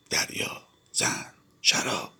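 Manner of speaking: slow breathless whisper. Acted. Narrator whispers Zorba's words to gather courage. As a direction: whisper the words like a secret charm.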